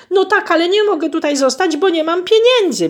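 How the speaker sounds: high, loud whiny complaint; whining lament. Acted. Goose whines to the owner about having no money. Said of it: "biadoliła gąska".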